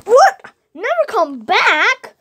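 surprised. Real, not acted. Child reading the book, shocked by the wording. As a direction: caught off guard by the line.

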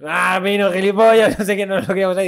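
group yelling at Pino; mock exasperated friends. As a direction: tease the friend in a put-on voice. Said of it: "Y nosotros".

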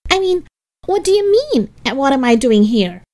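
high, loud, defensive questioning; brash recovery. A narrator's reading. Helga covering her surprise before Arnold, brash and defensive. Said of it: "snapped back to her usual Helga self".

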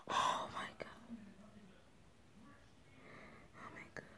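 barely audible awed whisper; stunned whisper. Real, not acted. Owner watching babies being born, whispering in amazement. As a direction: breathe it out under the breath, amazed.

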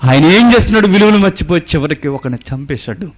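loud, high, urgent sermon warning; fiery preaching. Acted. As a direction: thunder the warning at the congregation. Preaching at full force. (గట్టిగా హెచ్చరించే ప్రసంగం)

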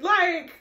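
loud high laughter; real laughter, high and loud. Real, not acted. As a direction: lose it laughing. Laughs at herself.

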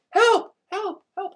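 high-pitched mock echo, repeated; echoing cry. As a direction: imitate a cry for help bouncing round a cave. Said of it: "it's echoing around".

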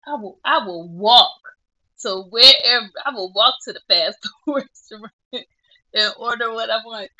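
loud, determined declaration, half laughing; vowing she'll walk there for food, loud and determined; emphatic vow. Real, not acted. Dieting vlogger to viewers, loudly vowing she'll walk for food.

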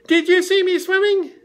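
very high, squeaky little voice; squeaky Roo. Acted. Roo calling to his mother, high and squeaky. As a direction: squeak it out like a tiny joey.

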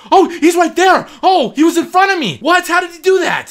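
high, loud frightened shout; high, loud startled fear; scared. Real, not acted. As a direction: caught off guard in fear. Slender Man in front.

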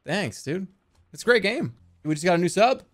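ordinary talk

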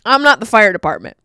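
loud, indignant retort; exasperated shout. Retold or imitated. Friend retorting he is not the fire department, loud. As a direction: snap it back, annoyed. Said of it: "He's like".

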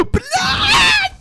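high loud frightened cry; startled scream. Real, not acted. Sudden monster attack.

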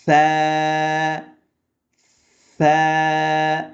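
"সা" as th, tongue between the teeth; tongue-out tha. Shown as a demonstration. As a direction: hold a long "সা" on a steady note, lisped. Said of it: "জিহ্বাটা মুখ থেকে বের হয়ে যাবে".

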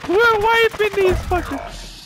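high, slow hyped call; excited hype. Real, not acted. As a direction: call it out pumped up. Hype during clutch.

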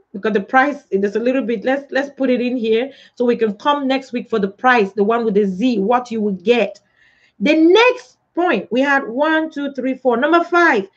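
usual speaking voice

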